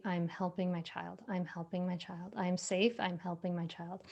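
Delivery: calm self-talk mantra. Shown as a demonstration. Parent calming themselves with a mantra, soft and steady. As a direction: reassure yourself under your breath. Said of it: "you can say".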